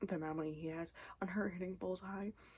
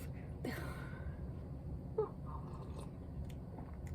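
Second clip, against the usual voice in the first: long, high, airy tired sigh; drawn-out weary sigh; 13 semitones higher, 5 dB quieter than usual. Real, not acted. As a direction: exhale the exhaustion. Exhausted morning sigh.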